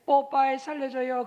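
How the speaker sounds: a hint of a begging child; mock cry for help. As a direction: call out like a helpless kid needing rescue. Believer crying out for a rescuer.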